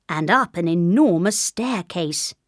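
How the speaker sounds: usual speaking voice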